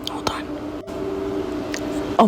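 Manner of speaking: scared, breathless whisper while filming; frightened whisper. Real, not acted. Mother alone at night filming the noises, frightened whisper. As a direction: whisper so the thing upstairs can't hear you.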